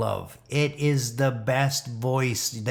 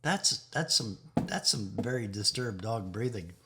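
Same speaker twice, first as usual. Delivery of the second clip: normal talking voice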